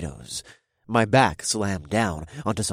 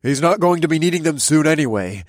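callous thug; 8 semitones higher, 9 dB louder than usual. Acted. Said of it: "another mysterious voice asked".

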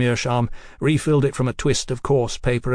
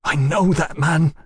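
slow, higher, agitated realization; trembling voice; 4 semitones higher, 3 dB louder than usual. A narrator's reading. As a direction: say it slowly, shaken. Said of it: "his voice trembling".

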